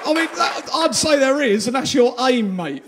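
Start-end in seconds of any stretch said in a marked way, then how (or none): none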